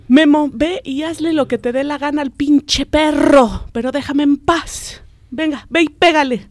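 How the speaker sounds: put-on a dad losing patience, waving the kid off; fed-up dad. Father at the newspaper tells nagging son, exasperated.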